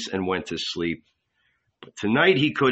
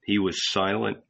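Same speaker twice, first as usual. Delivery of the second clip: ordinary delivery, nothing marked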